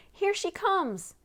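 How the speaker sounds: high, slow shout across the water. A narrator's reading. Bess shouting from the ship as Nancy rows up, high and slow. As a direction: call out to the boat far off. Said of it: "Bess shouted".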